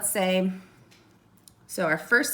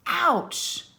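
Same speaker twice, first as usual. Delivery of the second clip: mild mock yell; 8 semitones higher than usual. Shown as a demonstration. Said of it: "So Lucy yelled".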